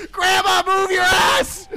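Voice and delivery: crying mock yell. Real, not acted. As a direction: yell at grandma through fake tears. Yelling at the game character.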